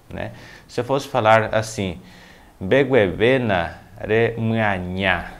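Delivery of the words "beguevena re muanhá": slow, word-by-word enunciation; slow model phrase. Shown as a demonstration. Teacher showing learners a Guarani phrase, slowly enunciated. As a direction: draw out each word for learners to follow.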